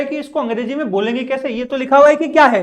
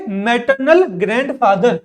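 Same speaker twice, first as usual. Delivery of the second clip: slow model answer; slower than usual. Shown as a demonstration. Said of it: "pronounce करो इन चीजों को".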